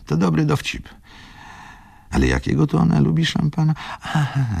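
high, giggly self-mocking musing; bitter amusement. A narrator's reading. Wokulski alone relishing his own joke, high amused voice. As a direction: let the pitch shoot up with mirth.

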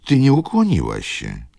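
high, loud grandmotherly reprimand; stern reproof. A narrator's reading. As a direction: pull the child up on manners. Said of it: "odezwała się staruszka surowo". (surowe upomnienie babci)

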